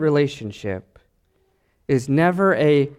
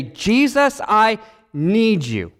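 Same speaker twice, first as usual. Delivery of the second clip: higher, slow, earnest plea to Jesus; a slow, raised cry of need; pleading prayer; 3 semitones higher, much slower than usual. Pretend. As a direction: call out to Jesus as if praying, drawn out.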